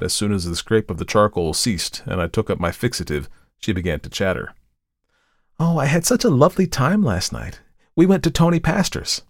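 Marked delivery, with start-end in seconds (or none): 5.6-9.2 put-on higher, bubbly young model's voice; chattering girl. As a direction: gush about last night. Tessie chatters excitedly.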